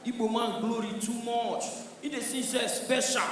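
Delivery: normal talking voice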